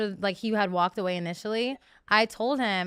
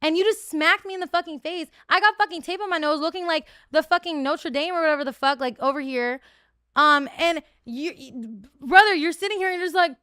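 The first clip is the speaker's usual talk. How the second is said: heated rant; 5 semitones higher, 4 dB louder than usual. Real, not acted. Ranting at ex.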